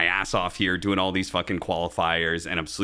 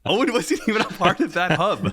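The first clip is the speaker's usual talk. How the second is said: much higher, talking through laughter; laughing protest; 11 semitones higher, 4 dB louder than usual. Real, not acted. Laughing protest at friend's teasing.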